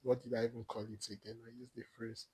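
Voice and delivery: usual speaking voice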